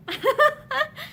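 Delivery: high, brief sad laugh; a small laugh through sorrow; sad little laugh. A narrator's reading. Pansy laughing sadly at parting, brief and high. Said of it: "She gave a sad little laugh".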